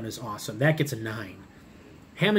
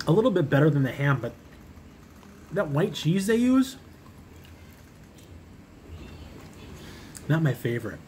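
chewing while talking; talking around food; mouth full. Real, not acted. Seen 1: bites slider before, grimacing closed-mouth jaw movement during words.

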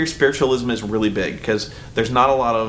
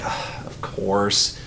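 sheepish whisper; 6 semitones lower, 5 dB quieter, much slower than usual. Retold or imitated. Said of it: "We're like".

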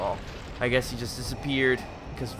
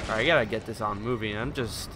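normal talking voice

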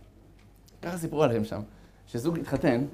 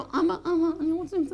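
high, tearful begging to father; childlike tearful plea; 12 semitones higher, 3 dB quieter than usual. Acted. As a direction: beg like a small child near tears. Pleading to God like a child.

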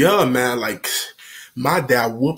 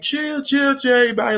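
mock panicked calming; 11 semitones higher than usual. Acting out calming a family quarrel.

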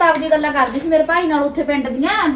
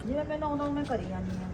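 sulky mutter; 14 dB quieter, faster than usual. Acted. Bride mutters while working.

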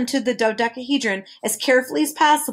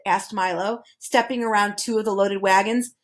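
ordinary delivery

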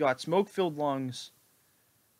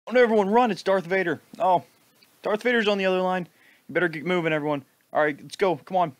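loud, higher fake alarm; mock panic; 4 semitones higher, 6 dB louder than usual. Warning viewers of Darth Vader in mock panic. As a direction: yell at everyone to flee, hammed-up.

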